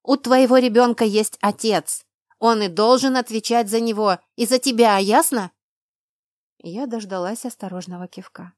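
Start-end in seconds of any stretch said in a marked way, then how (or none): 0.0-5.5 loud, firm scolding; angry insistence. A narrator's reading. Friend insists the child has a father, angrily. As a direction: lay down the law.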